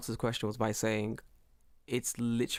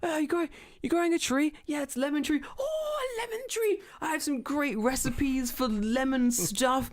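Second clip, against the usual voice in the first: put-on old woman chatting; 10 semitones higher than usual. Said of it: "And they're like".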